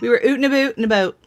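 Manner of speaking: put-on playful Canadian accent, fast. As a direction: echo it back in a Canadian accent. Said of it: "That was before my Canadian accent".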